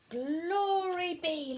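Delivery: high, drawn-out exclamation of dismay; crying out. A narrator's reading. Old lady sees the goat's mess, crying out. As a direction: stretch it into a storybook wail. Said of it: "The little old lady cried".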